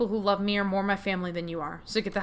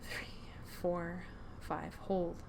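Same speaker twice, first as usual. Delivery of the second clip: much softer counted breaths; hushed breathing count; 3 semitones lower, 14 dB quieter, much slower than usual. Acted. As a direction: count slowly, barely audible.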